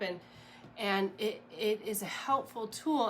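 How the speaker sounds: ordinary talk, nothing marked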